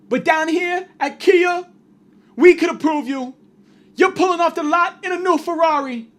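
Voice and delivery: high, loud hype-man pitch; radio dealership ad. Acted. Kia dealer sells to broke listeners on radio, shouted high hype. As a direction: shout each short phrase like a car-lot advert. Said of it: "the way that this ad goes is".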